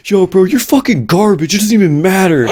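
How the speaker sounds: high-pitched mock shout of a sore loser; imitated trash-talk shouting. Losing team to the player who defused the bomb, mock shouting. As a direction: yell it like a losing team over game chat. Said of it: "you hear the other team shouting".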